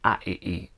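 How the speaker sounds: "A, e, e" as gravity's vowels without any consonants; vowels only. Shown as a demonstration. Teacher checking which vowel is stressed, "A, e, e" as bare vowel sounds. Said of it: "we're gonna take away the consonants".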